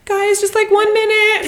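very high, squeaky mock plea; panicked teen singer. Teen singer stalling a waiting crowd, very high voice. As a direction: put on a tiny high voice, stalling fans. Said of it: "Why is your voice like that?".

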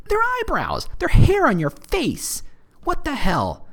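high-pitched incredulous outburst; mock disbelief. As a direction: squeak it out in comic bafflement. Mock disbelief about attractive eyebrows.

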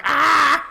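sudden high loud yelp of frustration; frustrated shriek. Retold or imitated. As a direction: let out a sharp exasperated cry. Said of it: "Tahani's like".

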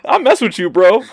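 high, laughing hype at Bane; excited praise for Bane. Retold or imitated. Fan gushing to Bane, high and laughing. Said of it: "There is no justice for".

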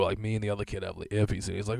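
ordinary talk

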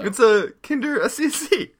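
cracking up at the joke; laughing. Real, not acted.

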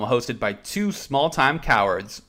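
big show intro, high and loud; radio announcer. Acted. Opens the show as host.

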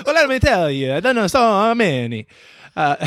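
high, playful mock Italian; fake Italian accent. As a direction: keep the joke Italian voice going. Answers in the fake Italian bit.

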